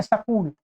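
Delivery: सकूल with a vowel between s and k; Haryana pronunciation. Retold or imitated. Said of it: "ऐसा बोलते हैं". (Haryanvi "सकूल", "स" और "क" के बीच "अ")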